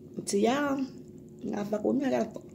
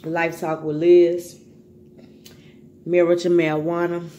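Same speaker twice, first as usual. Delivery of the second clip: shout-out call; 7 dB louder than usual. Real, not acted. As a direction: call out each name loudly. Calling out names in shout-outs.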